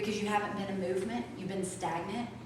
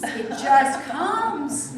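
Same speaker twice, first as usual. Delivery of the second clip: It just comes drawn out very slowly and louder; slow emphatic; 4 semitones higher, 7 dB louder, much slower than usual. Shown as a demonstration. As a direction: stretch It just comes right out. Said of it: "repeated for emphasis".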